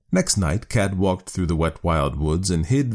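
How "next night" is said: ordinary delivery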